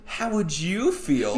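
put-on high, slow kid voice; cartoon kid voice. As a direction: ask it sweetly like a cartoon kid. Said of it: "He's like".

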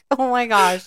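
high, loud oh my gosh through laughter; laughing exclamation. Real, not acted. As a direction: gasp it out laughing. Reacting after laugh.

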